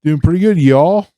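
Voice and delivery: a light put-on Southern accent, leaning on y'all. Co-host back from Nashville greets the listeners in a mock Southern accent. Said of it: "he didn't try to do the Southern accent".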